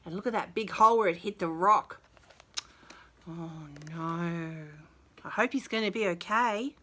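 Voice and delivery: usual speaking voice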